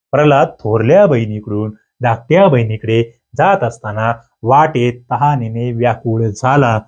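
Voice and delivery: measured dictation with gaps between phrases; deliberate model reading. Shown as a demonstration. Teacher shows students the correct word order, deliberate model reading.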